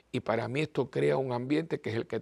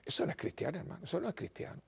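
hushed, whispered disapproval; whispered "eso no es cristiano", said fast at the end; whispered scold; 3 semitones higher, 11 dB quieter, faster than usual. Retold or imitated. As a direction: mutter it like a shocked churchgoer. Voicing a pious scold.

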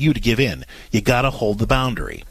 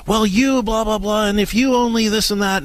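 mock angry husband; 10 semitones higher than usual. Husband raging back at his wife, mock angry and higher. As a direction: toss off his complaints in a higher mock rant.

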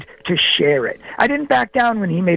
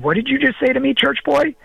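higher-pitched challenge, imitating the cook; mock confrontation; 7 semitones higher than usual. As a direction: play an affronted tough guy, retold. Retold confrontation with tough cook.

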